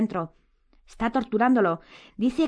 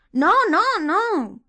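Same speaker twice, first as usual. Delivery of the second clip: high, loud, panicked denial; terrified denial; 13 semitones higher, 8 dB louder, much slower than usual. A narrator's reading. Hermione answers an angry Harry, terrified. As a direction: blurt it out in alarm. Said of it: "contestó Hermione aterrada".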